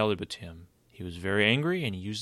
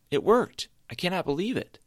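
a much higher, louder burst of joy; excited; 11 semitones higher, 5 dB louder than usual. A narrator's reading. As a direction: cheer out in disbelief. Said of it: "with excitement in his voice".

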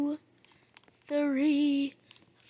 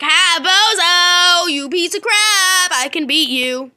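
mock boastful taunt, louder; 3 semitones higher, 8 dB louder than usual. Player imagining mocking the obstacle, taunting. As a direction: jeer at the obstacle. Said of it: "and be like".

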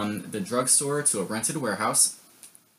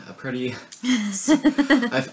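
laughing; 11 semitones higher, 8 dB louder, much slower than usual. Real, not acted. Laughing mid sentence.

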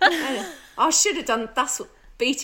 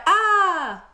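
excited; 9 semitones higher, 6 dB louder than usual. Retold or imitated. Mock Welsh greeting reaction.

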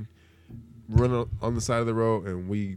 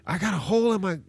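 mock upset older lady, pitched way up; high shrill lady voice; 11 semitones higher, faster than usual. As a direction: squeal it like an indignant lady. Angry lady voiced in story.

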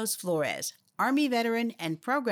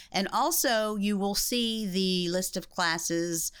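ordinary talk, nothing marked

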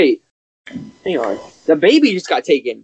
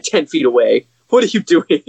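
normal talking voice

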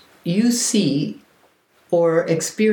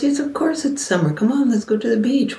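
higher, quick cheery friend voice; breezy friend; 6 semitones higher, faster than usual. Retold or imitated. Reliable friend reassuring you it's summer, breezy and quick. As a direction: toss it off like an obvious yes.

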